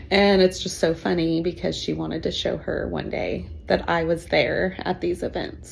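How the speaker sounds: ordinary delivery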